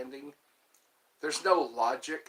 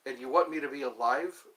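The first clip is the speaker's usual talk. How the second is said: ordinary talk, nothing marked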